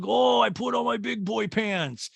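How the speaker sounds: put-on high, slow man-child voice; proud kid imitation, stretched out; overgrown little boy. Man acting like the kid in Big, high childish boast. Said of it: "Tom Hanks in the movie Big".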